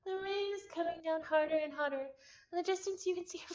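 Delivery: reading the game text aloud, a touch dramatic; visual novel narration. Acted. Streamer reading game text to chat, storytelling.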